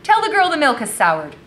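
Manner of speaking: high, loud called-out order; imperious mistress. A narrator's reading. Mistress ordering the cellar swept, imperious. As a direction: call it out high like a demanding mistress. Madam's order voiced in story.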